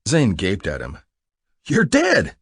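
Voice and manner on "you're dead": high, loud disbelief; shocked cry. A narrator's reading. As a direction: cry out as if seeing a ghost. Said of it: "he cried".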